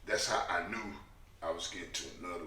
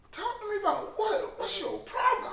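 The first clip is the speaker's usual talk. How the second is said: incredulous; 5 dB louder than usual. Retold or imitated. His reply to the crying lady.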